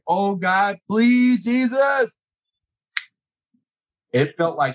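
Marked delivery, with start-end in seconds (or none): 0.1-2.2 a touch of desperate prayer. Retold or imitated. Sliding toward the trucks, praying in mild retold panic. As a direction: beg God as the truck slides. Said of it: "And I was like".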